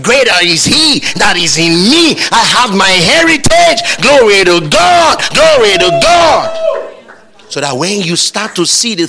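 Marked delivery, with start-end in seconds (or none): 0.0-6.6 loud declaration climbing to high shouted praise; preaching, building to shout. Real, not acted. Preacher claiming heritage before congregation, building to shouted praise. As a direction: build phrase by phrase into a full shout.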